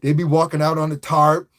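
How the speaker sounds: ordinary delivery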